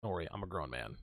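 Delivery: quiet reassurance. Retold or imitated. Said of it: "I'm like".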